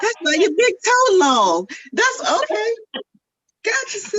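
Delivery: mocking catty woman. Mocking women's catty comebacks.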